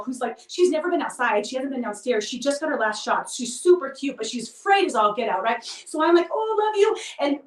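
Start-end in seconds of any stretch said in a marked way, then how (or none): 6.3-7.0 high cooing puppy talk; cooing to the scared puppy in her lap; baby talk to puppy. Retold or imitated. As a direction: gush up high at a frightened puppy.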